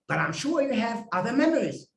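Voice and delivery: normal talking voice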